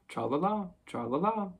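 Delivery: high, breezy little refrain; delightfully easy. A narrator's reading. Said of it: "in the most delightfully easy way".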